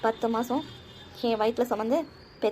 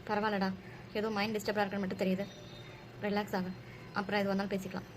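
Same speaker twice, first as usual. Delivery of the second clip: soft, low reassurance; gently calm your troubled child, voice lowered; tender, softened and unpressing; soothing mother; 3 semitones lower, 7 dB quieter than usual. Acted. Mother reassures her troubled son.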